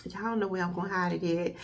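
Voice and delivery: usual speaking voice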